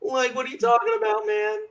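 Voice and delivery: high, far stoner voice; Shaggy impression. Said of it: "[name] can do a very excellent stoner impression".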